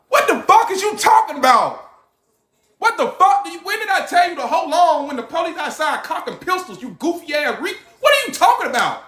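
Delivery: high, furious ranting shout; angry yelling. Retold or imitated. Man scolding his relative on the phone, furious high shout. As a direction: tear into her.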